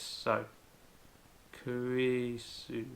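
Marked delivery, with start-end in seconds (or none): 1.6-2.8 Kurisu drawn out at half speed; very slow. Shown as a demonstration. Teacher showing how to sound out a name, very slowly. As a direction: stretch out each syllable of Kurisu, very slowly. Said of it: "slowly like this".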